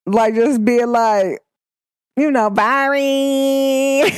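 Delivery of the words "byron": higher, laughing put-on voice; mock slang voice breaking into a laugh; put-on street voice, laughing. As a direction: play it up, then crack up laughing.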